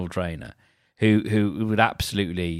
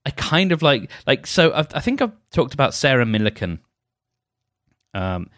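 ordinary delivery

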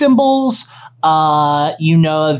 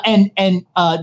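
ordinary talk

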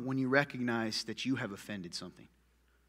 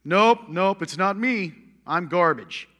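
lightly voiced self-putdown, louder on nope; 3 semitones higher, 9 dB louder, slower than usual. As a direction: play the gloomy believer refusing praise. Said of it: "culture ... idolize self-hatred".

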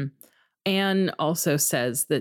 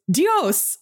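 hammy Spanish "Dios!"; a terrible mock Spanish cry; bad Spanish exclamation; 10 semitones higher, 4 dB louder than usual.

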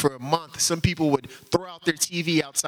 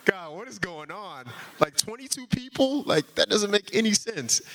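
higher, overwhelmed cry of disbelief; 8 semitones higher than usual. Retold or imitated. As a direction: sound emotionally overwhelmed, voice up high. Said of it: "I start bawling".

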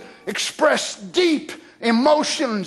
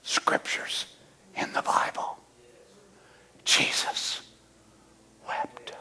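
hushed reverence; 10 dB quieter than usual. Acted. Preacher whispering the shortest verse to the congregation, reverent. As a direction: drop to a near whisper on the verse.

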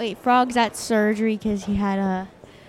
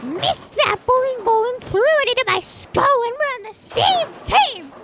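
much higher, indignant complaint; squeaky angry teammate; 10 semitones higher than usual. Acted. Teammate angry at Jeff for the hit, high squeaky voice. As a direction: whine at the team in high pitch.

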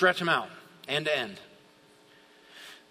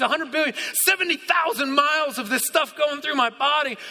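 high, loud, fervent praise rising to a shout; awestruck outcry; 8 semitones higher, 4 dB louder than usual. Acted. Preacher being like David amazed at God, fervent outcry. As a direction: cry out to God in overwhelmed wonder. Acts David crying out in awe.

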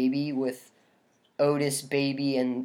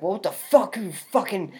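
imitating a snarling movie thug, not much louder; mock tough-guy rant; mocking a hammed-up movie villain; 3 semitones higher, 3 dB louder than usual. Friend retelling a villain's rant to co-host, hammed-up mock snarl.

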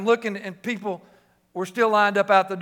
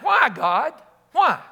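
retold cry of protest, raised pitch; 6 semitones higher, 4 dB louder, slower than usual. Preacher relives grieving his brother in church, crying out. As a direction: plead upward to God, re-enacted. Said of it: "crying out".

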